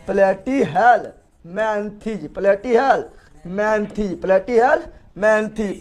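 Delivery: प्लेटीहेल्मिंथीज broken into slow, drawn-out parts; teacher slowly sounding out प्लेटीहेल्मिंथीज; slow syllable drill. Shown as a demonstration. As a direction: dictate प्लेटीहेल्मिंथीज piece by piece. Drilling the phylum name slowly.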